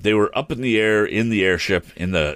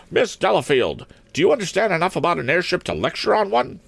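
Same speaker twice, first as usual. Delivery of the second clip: high-pitched, brisk headmistress question; smart, brisk; 12 semitones higher, faster than usual. A narrator's reading. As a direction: quiz her quickly in a high stern voice. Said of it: "asked Miss Perkman smartly".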